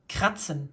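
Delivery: kratzen with a crisp ts in the middle; harsh T S sound. Shown as a demonstration. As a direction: hit the tz in kratzen as a hard T plus S. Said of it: "this harsh T S sounds".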